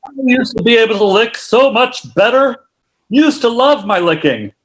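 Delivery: sad customer in an ad; whiny before-customer, slowed; infomercial testimonial. Acted. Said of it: "as an infomercial".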